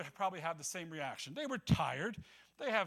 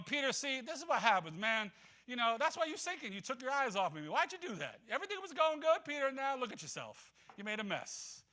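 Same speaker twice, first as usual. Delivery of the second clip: mock scolding; 8 semitones higher than usual. Jesus imagined scolding sinking Peter, mock exasperated lecture. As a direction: nag him like a disappointed teacher. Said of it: "He doesn't sit there and scold him".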